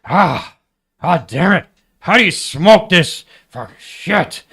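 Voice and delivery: high, loud, drawn-out cursing; hammed-up exasperated shout; imitated frustrated yelling. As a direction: yell like someone cursing in the next room. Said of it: "I would hear in the other room".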